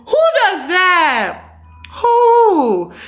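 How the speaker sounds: very high, slow, laughing disbelief; incredulous outrage. Real, not acted. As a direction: squeal it like you cannot believe it. Repeated outraged question.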